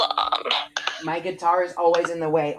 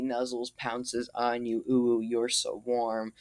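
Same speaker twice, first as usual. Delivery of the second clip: seductive reading; 6 dB louder than usual. Acted. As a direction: purr it like a joke seduction. Reads a cringe copypasta aloud.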